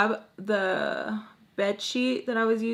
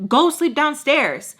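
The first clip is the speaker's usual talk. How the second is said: slow, high, louder retold command; stern repeated order; 7 semitones higher, 5 dB louder, slower than usual. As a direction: order it emphatically, imitating an irritated mom. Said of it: "you didn't hear me".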